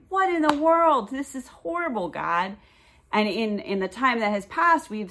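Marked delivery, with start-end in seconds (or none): 0.1-2.6 half-laughing, throw it up in disbelief; exasperated disbelief. Retold or imitated. Retelling her complaint to God about the move, dismayed. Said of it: "it was like".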